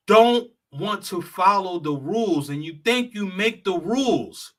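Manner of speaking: usual speaking voice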